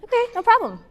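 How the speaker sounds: high, easy "okay, no problem"; bright reassurance. Retold or imitated. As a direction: let it go cheerfully. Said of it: "No, I don't wanna talk".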